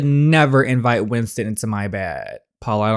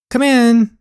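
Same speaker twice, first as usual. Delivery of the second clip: mock sweet invite; 12 semitones higher, 8 dB louder than usual. Voicing character inviting Ryder in.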